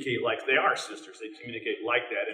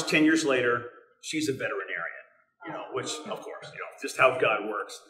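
usual speaking voice

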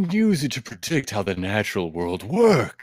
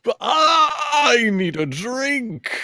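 high, slow, wailing defeat; mock crying wail; 10 semitones higher, much slower than usual. Losing debater wails at his opponent, high and slow. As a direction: whimper it out like a sore loser. Losing the math rap battle.

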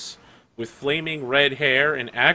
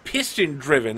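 ordinary talk, nothing marked

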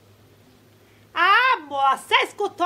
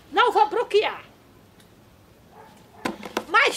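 ordinary talk

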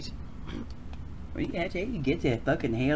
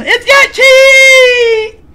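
squeaky high voice; 17 dB louder, much slower than usual. Acted. High excited character.

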